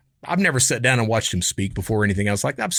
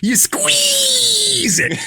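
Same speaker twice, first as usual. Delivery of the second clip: very high and slow, stretching squeeze; high, drawn-out squeeze; much slower than usual. Acted. Mock-dramatic answer, drawn out.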